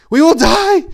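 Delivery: very high, loud terrified cry; loud terrified wail; panicked cry. A narrator's reading. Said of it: "he cried, panting".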